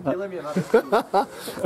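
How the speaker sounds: loud, amused burst of laughing; real laughter. Real, not acted. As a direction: laugh out loud after your own tease. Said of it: "sorry I'm laughing".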